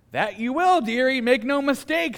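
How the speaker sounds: a lightly put-on old dearie voice; lightly voiced Missus Beaver. Preacher reads Missus Beaver warning Lucy, lightly voiced. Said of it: "says Missus Beaver".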